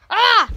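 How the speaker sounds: loud high startled shout; sharp surprised yell. Pretend. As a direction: yelp in surprise. Sudden cry.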